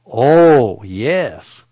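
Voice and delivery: loud, drawn-out exclamation, easing on "yes"; excited. Retold or imitated. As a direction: burst out with a big, thrilled "Oh". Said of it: "I was so excited".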